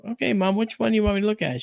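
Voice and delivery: lightly voiced daughter asking her mom; mother's voice. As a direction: rattle it off brightly as the daughter.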